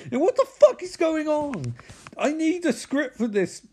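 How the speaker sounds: high-pitched mock rant; exasperated outburst. Confused boss to his profiler, high exasperated outburst. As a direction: demand answers at the top of the register. Boss loses it in acted argument.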